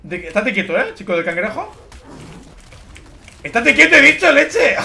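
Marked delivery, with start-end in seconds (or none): 3.4-4.8 loud, high scolding. Real, not acted. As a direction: tell them off loudly. Scolding teammates messing around.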